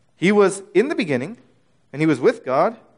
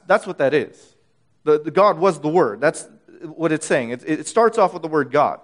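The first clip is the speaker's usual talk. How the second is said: ordinary talk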